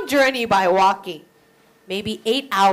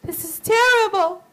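crying; 9 semitones higher, 4 dB louder than usual. Acted. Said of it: "She would cry and say".

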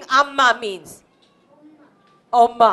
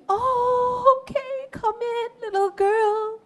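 high, soft sing-song sympathy; tender welcome; 10 semitones higher, 5 dB quieter than usual. Acted. Amy lets the runaway girl in, tender welcome. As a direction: coo it softly to a frightened child.

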